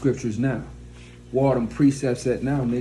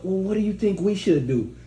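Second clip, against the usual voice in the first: a touch higher, tentative asking; indecisive man; 4 semitones higher than usual. Retold or imitated. Weak man asking his woman for leadership, mildly mocking. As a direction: lightly mimic a man seeking guidance. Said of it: "man asking you damn how you feel".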